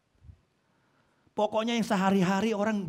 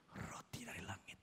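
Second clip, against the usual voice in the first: bored whisper; 4 semitones lower, 24 dB quieter than usual. Acted. Bored Israelites, fading enthusiasm.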